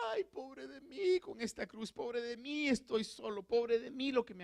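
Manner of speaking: mock whimpering self-pity, lightly done; mock self-pitying Jesus. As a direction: ham up a self-pitying moan. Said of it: "No, hermano".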